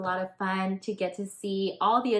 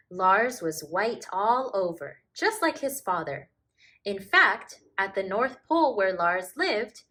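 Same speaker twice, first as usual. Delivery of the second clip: gentle, lively storybook reading; reading to children; 3 semitones higher, 5 dB louder than usual. A narrator's reading. Narrator reads a polar bear tale to children, gentle storybook voice. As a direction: read it like a bedtime picture book. Reads picture book aloud.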